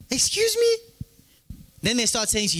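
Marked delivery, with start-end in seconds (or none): 0.1-0.7 sharp, high mock-offended "excuse me"; high, indignant teen's "Excuse me?"; indignant teen retort. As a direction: act the offended teenager snapping back. Teen answering adults back in disbelief.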